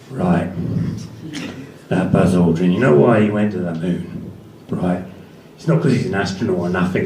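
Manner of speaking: low Cockney growl, paranoid and insistent; Ray Winstone impression. Comic doing Ray Winstone ranting about the moon landings, low Cockney. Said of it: "the day was kind of something like this".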